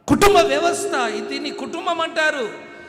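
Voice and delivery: loud, high pulpit shouting; full-force sermon shouting; fiery preaching. Acted. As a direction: thunder it at the congregation.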